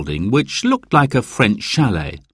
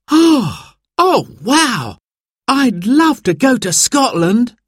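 put-on very high, loud thrilled shout of a boy; young boy overjoyed at the Scotland trip; excited cry; 4 dB louder, slower than usual. A narrator's reading. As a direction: burst out with delight. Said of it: "cried Simon".

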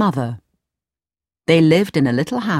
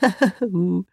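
short gloating wizard laugh; sly chuckle; 2 semitones higher, 3 dB quieter than usual. Acted. Wizard pleased at fooling Aladdin, sly chuckle. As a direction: chuckle slyly to yourself.

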